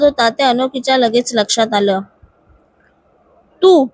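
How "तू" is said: sudden loud startled bark; short loud burst at the intruder; shocked shout. Acted. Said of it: "Arjun जोरात ओरडला".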